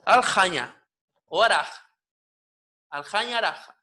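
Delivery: "aljaña", "araj" with a long scraped J; raspy J. Shown as a demonstration. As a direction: rasp the J at double length in each word. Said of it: "una J raspada".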